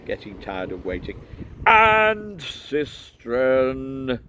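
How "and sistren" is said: loud, long-drawn call; slow shouted address; shouting. A narrator's reading. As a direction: stretch it out loudly over a crowd. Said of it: "he shouted".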